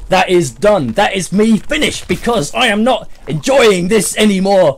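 loud, emphatic fed-up rant; seething with anger. Real, not acted. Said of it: "I am seething with anger".

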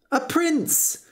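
high-pitched exclamation of surprise; astonished cry. A narrator's reading. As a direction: cry out in amazement, pitch leaping. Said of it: "cried George".

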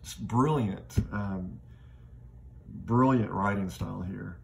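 ordinary talk

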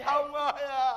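hammed-up sobbing lament; fake crying. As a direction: bawl theatrically for the dead. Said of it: "Khóc vậy?".

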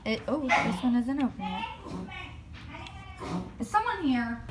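ordinary talk

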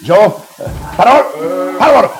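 calling out. Acted. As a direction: call him over, rising at the end. Father calls Parmor to announce news. (strigare pe nume, cu glas ridicat)

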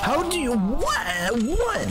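high, baffled outcry; shocked disbelief. Real, not acted. Disbelief at game.

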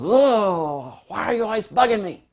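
loud, high-pitched whining protest; mock groan into an annoyed complaint; exasperated child. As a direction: imitate a kid fed up with nagging. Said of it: "and the child goes".

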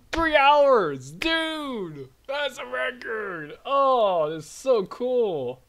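high, loud burst of amazement; real excitement. Real, not acted. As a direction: blurt it out, thrilled and loud. Excited celebration of own game.

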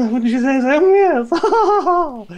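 high, loud, hammed-up toff; a shrill-free but high, loud upper-class put-on; terrifying posh voice. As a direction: push the silly posh voice over the top. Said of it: "The voice is just getting more and more terrifying".

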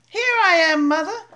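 loud, eager high call. Acted. Baby bird calling to the passing plane, loud and eager. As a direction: call up to the plane hoping it's her. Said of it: "he called out".

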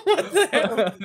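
laughing. Real, not acted. Laughing shock.

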